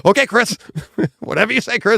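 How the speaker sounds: high, giggly placating voice; sing-song mock yes-man to director Chris; mock humoring crew. Crew humoring Nolan, laughing.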